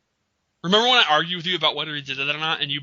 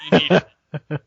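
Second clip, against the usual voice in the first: loud hype; 10 dB louder, slower than usual. Acted. Hyping the award loudly.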